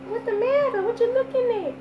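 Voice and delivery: put-on high, cooing baby talk. Mom retells cooing to her babies at night. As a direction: coo at the babies from your bed.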